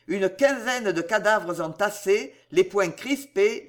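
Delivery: ordinary delivery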